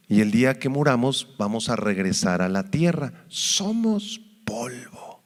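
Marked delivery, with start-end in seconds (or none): 3.3-5.2 slow, breathy hush of a whisper. Acted. Preacher reminding the church they are dust, whispered. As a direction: let it drop to a slow whisper.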